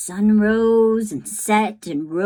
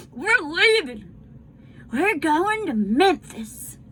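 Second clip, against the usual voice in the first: rally yourself and the doll; boy's voice; 9 semitones higher than usual. Acted. Bryce tells his doll Jangles they are leaving, resolute.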